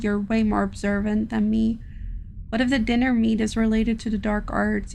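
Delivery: ordinary talk, nothing marked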